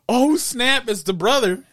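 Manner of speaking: high, loud burst of realisation; mock surprise. Viewer reacting to the brother twist, loud high surprise.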